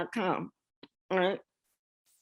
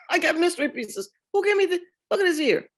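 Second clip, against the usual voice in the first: much higher, tumbling and giddy; giddy excitement; 9 semitones higher, faster than usual. Retold or imitated. Retelling the cousin comparing ears with her, giddy and high. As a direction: bubble over about the family ears.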